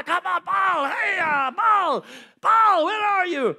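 high, shouted pleading call. Retold or imitated. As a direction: cry out to an absent god. Prophets calling on Baal.

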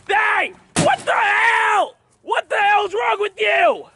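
high, drawn-out outraged yell; angry shouting. Real, not acted. Vlogger confronts Tyler over the TV, outraged shout. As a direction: yell in real outrage, stretching the words.

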